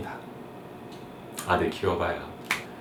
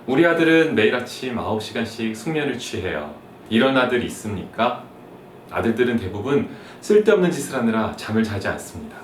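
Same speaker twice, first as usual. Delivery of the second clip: usual speaking voice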